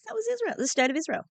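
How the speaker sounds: high, fast mocking jab; sarcastic aside. Real, not acted. Commentator jabbing at the state's claim, sarcastic. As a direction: toss it off with a fast high sneer.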